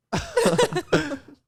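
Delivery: high, nervous burst of laughter; awkward laugh. Real, not acted. Awkward moment after teasing.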